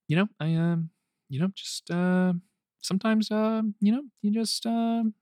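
lightly voiced Bateman, soft and halting; Jason Bateman impression. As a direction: hedge every word, soft and unsure. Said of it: "my impression of Jason Bateman in Ozark".

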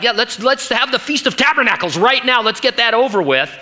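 a touch of excited impatience; Peter. Acted. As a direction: urge everyone on, keen to get started. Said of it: "And so Peter's like".

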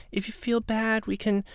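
high, airy imitation of her; lightly mocking a timid offer; imitating a meek housemate; mock sweet voice. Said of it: "she's like".

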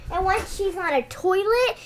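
normal talking voice

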